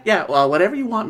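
ordinary delivery

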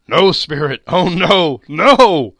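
loud, high cries of refusal; anguished protest, rising in force; desperate pleading. A narrator's reading. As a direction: beg loudly in horror. Scrooge begs the spirit at grave.

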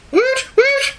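high excited cheer; a squeaky little celebratory woot. Retold or imitated. Reading the fan's email with a high mock cheer.

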